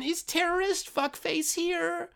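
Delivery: very high, slow teacher calling out the name; teacher mangling a name during roll call; mock teacher. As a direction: sweetly sing the insult like a roll call. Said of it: "Of course, um".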